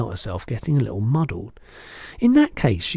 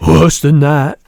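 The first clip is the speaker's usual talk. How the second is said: loud, higher giant's cry; jovial roar; 5 semitones higher, 13 dB louder, slower than usual. A narrator's reading. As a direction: roar it out with gusto. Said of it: "cried the B F G, roaring with laughter".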